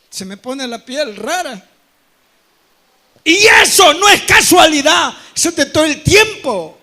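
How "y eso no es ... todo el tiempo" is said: loud preaching shout; fiery sermon proclamation. Real, not acted. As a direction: hammer it at the congregation. Preaching at full force.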